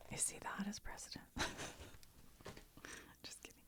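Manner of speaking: ordinary talk, nothing marked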